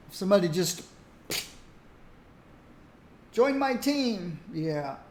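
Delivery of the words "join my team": rude demand. Retold or imitated. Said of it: "Very rude".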